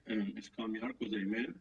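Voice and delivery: faint voice, sounds like in a cave; distant muffled voice on a bad line; barely audible. Real, not acted. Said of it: "I can barely hear you".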